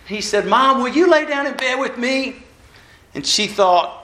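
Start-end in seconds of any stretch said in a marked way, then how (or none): 0.5-2.4 higher-pitched, lightly voiced child; pleading little boy. Scared boy asks mom to stay, lightly pleading. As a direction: imitate a boy asking for comfort. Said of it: "a little boy who was laying".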